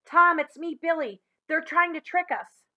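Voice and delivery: high, loud pleading; boy urging his friend at midnight; urgent call. Acted. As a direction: keep shouting urgently to Tom. Billy urgent at Tom's tent.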